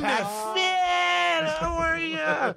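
high, shouted phony hello; hammed-up greeting. Phony nice guy greeting Pat Finn, hammed-up high shout. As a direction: call out high, gushing with fake delight.

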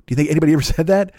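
ordinary talk

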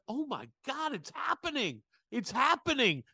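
retelling the moment, giddy high-pitched excitement; retold excitement. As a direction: squeal it like the moment is finally here. Speaker's own excitement, retold.

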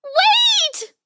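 very high, squeaky mouse protest; high-pitched little shriek; shrieking tiny mouse. A narrator's reading. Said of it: "a small shriek of protest".

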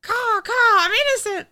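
shrill squawking crow, laughing; shrill screeching bird woman. Pretend. Voicing the crow.

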